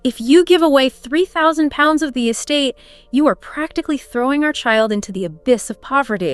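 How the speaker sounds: Fanny's guilt trip. Acted. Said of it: "She says".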